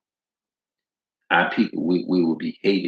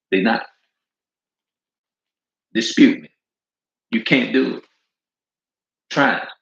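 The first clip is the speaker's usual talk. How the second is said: defiant challenge; 4 semitones higher, 4 dB louder than usual. Real, not acted. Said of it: "preacher daring the audience".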